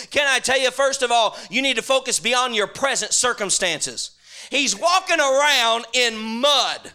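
loud, driving preacher cadence; full-force preaching. Acted. Preacher rousing his congregation, loud full-force preaching.

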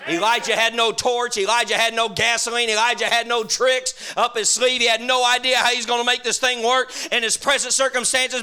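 loud, driving preacher cadence; full-force preaching. Acted. Preacher rousing his congregation, loud full-force preaching.

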